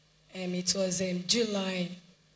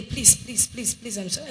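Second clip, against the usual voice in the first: ordinary talk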